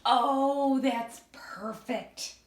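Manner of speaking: usual speaking voice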